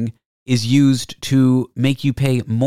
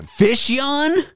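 incredulous, pitched way up; can't believe fish yawn; shocked high question; surprised; 14 semitones higher than usual. Real, not acted. Incredulous echo of fish yawning.

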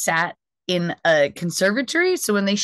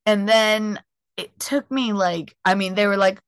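ordinary talk

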